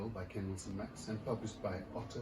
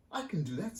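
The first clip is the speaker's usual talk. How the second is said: put-on higher, eager little girl; 7 semitones higher than usual. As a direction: sound like a small dreamy girl. Astrid voiced.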